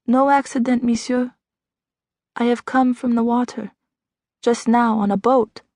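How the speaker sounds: put-on French accent, stranger answering the doctor. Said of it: "What's that accent?".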